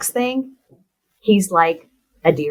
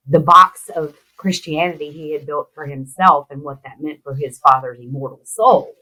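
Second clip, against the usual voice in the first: usual speaking voice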